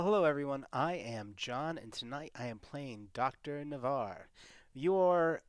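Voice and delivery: ordinary delivery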